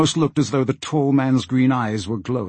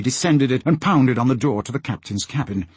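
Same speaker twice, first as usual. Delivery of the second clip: ordinary delivery, nothing marked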